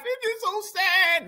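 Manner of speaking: mock tearful woman. Mocking a woman he says cries without tears, fake sad. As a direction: pretend to cry without tears. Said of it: "No tears".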